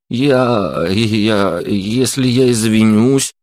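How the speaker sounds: halting, drawn-out stammer; timid, stumbling attempt at apology; frightened stammer. A narrator's reading. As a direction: falter on each word, afraid to finish.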